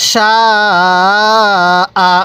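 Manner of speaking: "সা" held very long, then a short "আ"; long madd. Shown as a demonstration. Teacher shows the four-alif rule, long drawn-out "সা" then short "আ". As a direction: stretch "সা" four counts before cutting to "আ". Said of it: "তাহলে কিভাবে পড়ব?".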